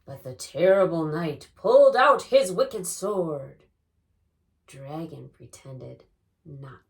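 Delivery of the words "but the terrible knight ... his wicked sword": loud, dramatic read-aloud voice; booming. Acted. Boy reading his story near a dragon, booming. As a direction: boom out the tale at full volume. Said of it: "read aloud in a booming voice".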